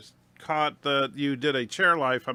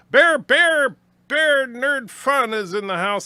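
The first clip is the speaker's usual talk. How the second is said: hype call; 9 semitones higher, 5 dB louder, slower than usual. Acted. Hyping a chatter's arrival on stream.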